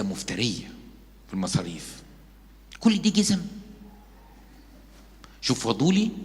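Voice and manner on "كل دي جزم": high, shocked gasp at all the shoes; mock astonishment. Preacher mimics a nosy woman, mock shocked. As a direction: act amazed at the pile of shoes.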